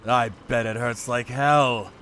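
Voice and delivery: ordinary delivery, nothing marked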